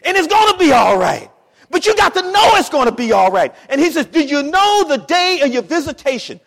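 high, shouted preacher's assurance; full-force preaching. Acted. Preacher telling the congregation it will be all right, high loud shout. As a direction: drive it home to the congregation.

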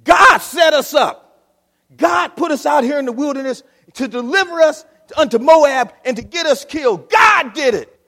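king yelling that God set them up; insisting king. Acted. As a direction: shout the blame at God. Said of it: "he insisted. Again, he said".

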